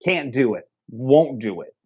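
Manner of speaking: normal talking voice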